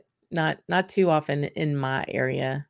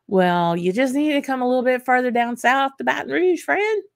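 playful invite; 9 semitones higher, 4 dB louder than usual. Real, not acted. Playfully inviting viewer down south.

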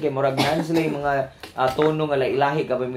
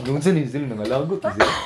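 ordinary talk

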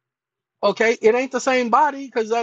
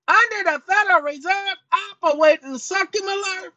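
"Under the Federal Reserve Operating Circular" in a much higher, mangled character voice; the alter ego stumbling through "Operating Circular", pitched way up; high sidekick voice reading "Federal Reserve"; cranky alter ego; 8 semitones higher than usual. Acted. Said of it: "sometimes he gets in my chair".

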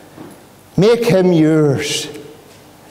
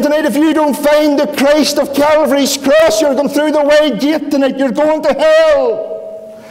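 fiery preaching; 8 semitones higher than usual. Acted. Preacher warning the congregation of hell, fiery preaching. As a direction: thunder the warning of the wide gate.

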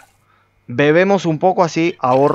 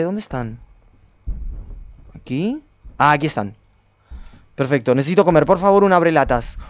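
normal talking voice

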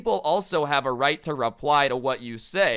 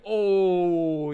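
long, high, loud jeering "oh"; imitated classroom ooooh; class "ooh" reaction; 8 semitones higher, 6 dB louder than usual. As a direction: draw it out like a crowd hearing a burn. Whole class reacting to the burn.

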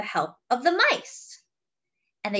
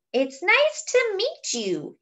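higher, slow gentle dragon voice; shy dragon Franklin; 9 semitones higher, slower than usual. Acted. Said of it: "he says".

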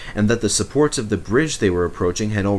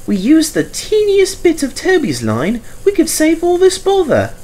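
high, wheedling engine voice; coaxing suggestion; 5 dB louder than usual. A narrator's reading. Mavis wheedling her driver, high and coaxing. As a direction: coax him sweetly, high. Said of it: "she suggested to her driver".

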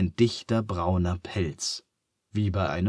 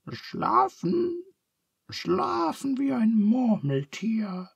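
Charles, much higher and slow, grunting half asleep; sleepy grunt; slower than usual. A narrator's reading. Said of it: "grunzt Charles". (Charles grunzt schläfrig, hoch und langsam)

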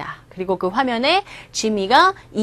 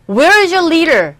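alien demand; 6 semitones higher, 6 dB louder, much slower than usual. Acted. Teacher acts alien asking.

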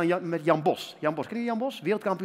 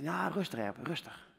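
calming voice; 3 semitones lower, 7 dB quieter, slower than usual. Retold or imitated. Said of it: "Ik zei".

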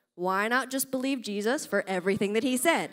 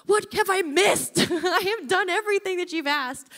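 laughing protest; 6 semitones higher than usual. Retold or imitated. Retold protest to God, laughing.

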